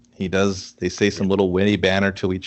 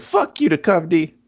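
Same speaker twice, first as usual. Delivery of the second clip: mock angry yell; 9 semitones higher than usual. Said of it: "Everybody's like".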